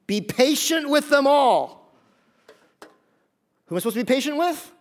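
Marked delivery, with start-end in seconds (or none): 0.0-1.9 slow, loud, high emphasis; emphatic reading. Shown as a demonstration. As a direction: stress it so they circle it. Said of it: "You gotta circle this one".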